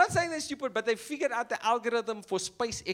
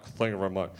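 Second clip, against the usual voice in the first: lower-pitched mimicked thank-you; mock thank-you; 11 semitones lower, faster than usual. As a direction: drop the pitch for a put-on thanks. Mimics someone doing a job.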